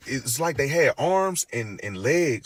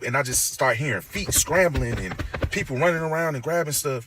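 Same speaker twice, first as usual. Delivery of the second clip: ordinary talk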